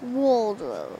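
low, soft "Wardrobe" starting like "wall". Shown as a demonstration. Pupil trying the tip, wall-like "Wardrobe". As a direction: try "Wardrobe" as "walldrobe", English style. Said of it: "almost as if you're saying wall".

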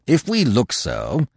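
slow, stern retort; harsh. A narrator's reading. Manfred answers the frightened Terry, harshly. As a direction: answer severely, weighing each word. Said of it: "said Manfred harshly".

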